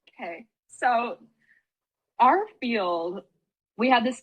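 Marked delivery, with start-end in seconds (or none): 0.1-0.5 meek okay. Retold or imitated. Cowed reply after being yelled.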